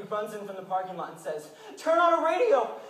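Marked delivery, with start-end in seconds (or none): 1.8-2.8 urgent shout. Retold or imitated. Man bursts into prayer meeting.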